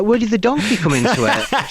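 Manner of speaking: high-pitched mock bafflement; incredulous wife. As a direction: question it in a high, put-on wife's voice. Wife challenging his embellished story.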